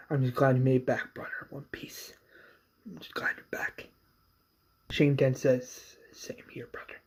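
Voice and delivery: two brothers whisper in relief; hushed reunion. Retold or imitated. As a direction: whisper it mid-hug. Brothers hug, quiet words.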